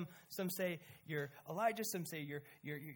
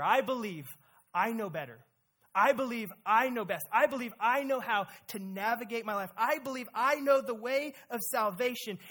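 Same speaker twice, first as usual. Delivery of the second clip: mock proud boast; 3 semitones higher, 7 dB louder than usual. Preacher voicing self-belief.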